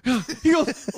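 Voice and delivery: normal talking voice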